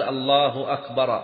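أكبر ending in an a vowel, "akbara"; slow call, أكبر closed on "-ra"; wrong-ending demo. Shown as a demonstration. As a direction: accusative -a on أكبر. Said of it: "أكبر بالنصب".

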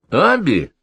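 jovial, teasing call of her name; laughing off her rage with good humour; cheerful. A narrator's reading. Friend cheerfully calls out to the furious landlady. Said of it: "весело сказал ей Перельман".

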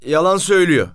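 indignant outburst cutting in; loud accusation. A narrator's reading. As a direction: cry out in accusation. Driver breaks in with an accusation.

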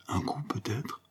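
breathy hushed question; anxious whisper at the bedside. Acted. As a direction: whisper it so the mother won't hear. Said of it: "fit-elle tout bas".

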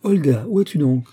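loud, high searching call. A narrator's reading. The princess searches for Olga, calling out. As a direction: call out for a missing daughter. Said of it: "fit la voix de la princesse".

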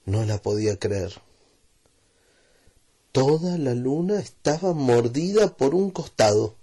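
ordinary delivery, nothing marked

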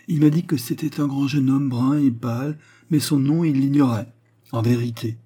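lightly acted eager courtesan; earnest young confidante. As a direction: report it emphatically. Turquoise answers eagerly.

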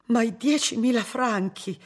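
high-pitched, aghast question; horrified gasp. A narrator's reading. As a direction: ask it aghast, pitch leaping up. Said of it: "chiesero le sorelle ansimando inorridite".

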